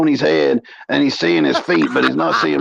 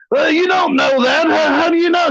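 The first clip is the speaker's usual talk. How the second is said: much higher, squeaky protest; mock indignant Rebel; 12 semitones higher than usual. Rebel challenging Mo how she knows, in a mock high squeak. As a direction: pitch it way up, defensive. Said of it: "Rebel protesting again".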